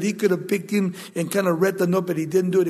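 ordinary talk, nothing marked

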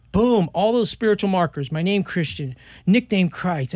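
ordinary delivery

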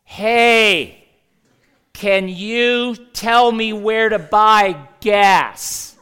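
slow, high, booming shout; volume turned up. Acted. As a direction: shout each word slowly at someone who doesn't understand. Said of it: "we turn up the volume".

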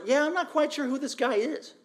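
high-pitched, sceptical mock voice; doubtful onlooker. Preacher voices an unsure onlooker in the crowd, doubtful. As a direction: put on a high voice of wary doubt.

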